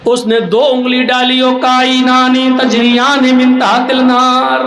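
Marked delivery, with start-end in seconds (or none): 1.4-4.6 mock recitation. Mock Quran recitation by cleric.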